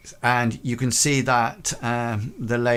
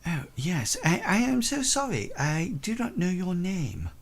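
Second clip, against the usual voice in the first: higher French governess voice; French accent; 9 semitones higher than usual. Acted. French governess apologises to Peabody, higher French accent. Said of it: "looks at you and says".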